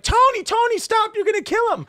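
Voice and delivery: high, loud frantic scream out the window; imitating a panicked mother yelling; mom screaming. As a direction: shriek his name in panic. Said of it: "she starts screaming out the window".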